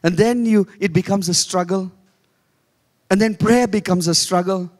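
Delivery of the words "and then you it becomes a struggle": normal talking voice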